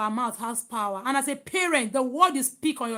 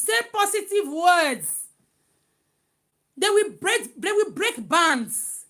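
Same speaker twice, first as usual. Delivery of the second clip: prophetic declaration; 3 semitones higher, 3 dB louder than usual. Acted. Mother declaring blessings over her children, preaching. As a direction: proclaim it like a fervent prayer.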